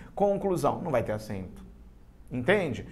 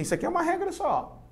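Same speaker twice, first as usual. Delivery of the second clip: higher, quicker voice for quoted authors; pitched-up, hurried quotation; 7 semitones higher, faster than usual. Retold or imitated. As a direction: imitate scholars making their claim, high and brisk. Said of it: "Muitos autores dizem".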